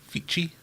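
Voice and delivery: FICHI said with a ch, "fee-chee"; ch guess. Shown as a demonstration. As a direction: try Fichi with ch as in cheese. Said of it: "kinda looked like fishy".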